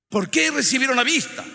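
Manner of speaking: high, loud rhetorical question; loud pulpit challenge; full-force preaching. Real, not acted. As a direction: fire the question at the congregation.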